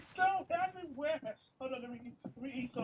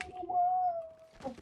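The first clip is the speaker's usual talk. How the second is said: triumphant; 4 dB louder, much slower than usual. Acted. Pet triumphant on high spot.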